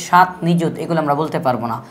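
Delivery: ordinary delivery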